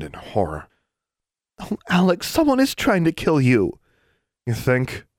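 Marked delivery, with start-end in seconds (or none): 1.6-3.8 put-on high horrified girl; horrified friend. A narrator's reading. Anna horrified. 4.5-5.1 frustrated sarcasm. A narrator's reading. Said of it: "frustrated and sarcastic".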